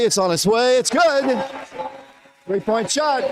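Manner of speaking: rising excitement as the three falls; half-shouted play-by-play joy; excited call. Real, not acted. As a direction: call the made three with a lift. Three-pointer drops in.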